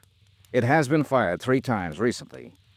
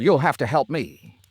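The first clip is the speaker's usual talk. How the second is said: ordinary talk, nothing marked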